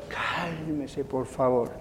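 soft, stretched-out soothing word; low, tender request; gentle calming plea. Real, not acted. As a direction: ease the listeners down softly. Soothing the congregation.

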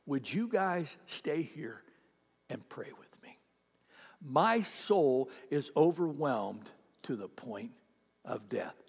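soft, slow, sinking into a whisper; hushed plea. Retold or imitated. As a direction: plead gently as if overwhelmed. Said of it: "and he said to them".

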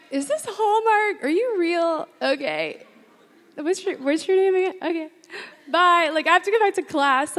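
giddy disbelief. Retold or imitated. Flustered by a charming Italian, giddy and high. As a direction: squeal it like a rom-com heroine.

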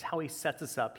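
usual speaking voice

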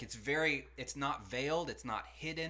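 ordinary delivery

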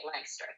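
LANCASTER squashed to LANK-ster; lazy Delco pronunciation. Shown as a demonstration. Friend showing Delco lazy talk, LANK-ster run together. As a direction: drop the middle of LANCASTER, lazy talker style. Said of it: "it's a lazy talker".

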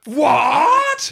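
very high, loud startled cry; shocked. Acted. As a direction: shriek a stunned what. Sage shocked again.

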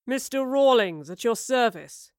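slow, smug introduction with a smile; smiling. A narrator's reading. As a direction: introduce yourself with leisurely relish. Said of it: "he smiled".

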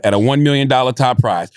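usual speaking voice

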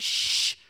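long, loud "shh"; forceful shush. Retold or imitated. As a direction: hush a whole storm with one shush.

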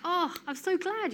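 high, slowed-down delight; mock-sincere delight, high and slow; glad reply. Her own reply quoted, high slow.

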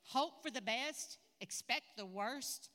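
slow, deliberate, each word spaced; slow dictation. Shown as a demonstration. Preacher dictates the saying slowly for note-takers. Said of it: "write that down".

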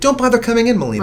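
high-pitched put-on voice letting someone off; mock considerate reply; imitated excusing reply. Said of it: "so that you say".

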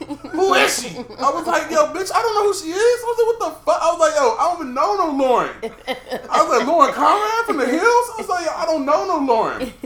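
high-pitched, loud, rapid retold protest; hammed-up squealing disbelief at top of range; frantic denial. Boyfriend denying he knows any Lauren, frantic high protest.

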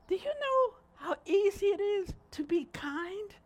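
ordinary talk

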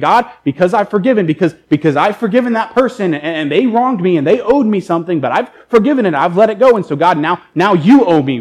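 acting a disciple pressing God to pay up; mock indignant bargaining; entitled demand.

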